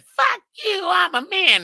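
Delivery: high shouted mimicry of the niece's yell; mock shout. Retelling her shop outburst to a friend, high mock shout. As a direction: shriek it like she did in the shop. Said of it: "Everything stopped when she said".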